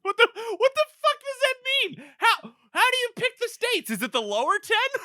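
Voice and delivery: laugh-strangled outrage at the stat; incredulous rant. Real, not acted. The friend rants about the odd stat, in very high laughing disbelief.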